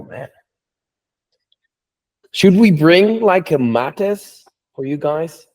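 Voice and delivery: ordinary delivery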